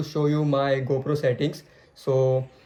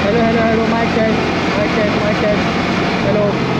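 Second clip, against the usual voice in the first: muffled mic test; 6 semitones higher, 5 dB louder than usual. Real, not acted. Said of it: "my helmet visor closed".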